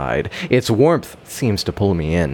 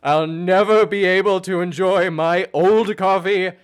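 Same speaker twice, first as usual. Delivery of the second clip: sinister realization; 12 semitones higher, slower than usual. A narrator's reading. Ominous realisation, ending twist.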